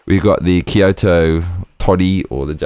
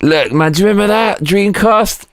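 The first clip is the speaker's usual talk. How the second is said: much higher, squeaky excited question; excited nostalgia; 11 semitones higher than usual. Real, not acted. Showing viewers the Dreamcast, a high excited gush.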